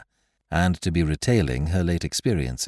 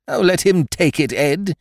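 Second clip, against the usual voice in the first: high, loud wifely outburst; wife's cry; 10 semitones higher, 5 dB louder than usual. Acted. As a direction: cry it out high, pleading with a husband. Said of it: "cried his wife".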